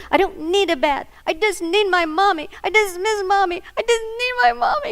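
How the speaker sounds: put-on high, loud, tearful little boy; crying child. Grandson refusing a bath, crying loudly for his mommy. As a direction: wail like a small boy breaking into tears.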